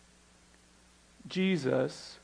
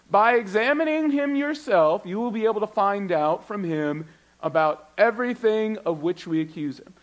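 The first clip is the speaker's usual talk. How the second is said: dramatic scripture reading; 6 semitones higher, 6 dB louder than usual. Acted. Reading the charge aloud.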